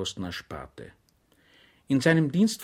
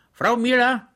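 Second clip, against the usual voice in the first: Schwejk calls out, higher and louder; a raised call to the kitchen; calling from bed; 11 semitones higher, 6 dB louder than usual. A narrator's reading. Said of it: "die leise Stimme Schweigs". (Schwejk ruft die Bedienerin, höher und lauter)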